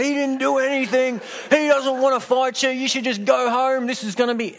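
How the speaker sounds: high, put-on voice of pleading mates; lightly mocking, high voice for the unhelpful mates. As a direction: squeak it out like nervous friends. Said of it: "they didn't help much, saying".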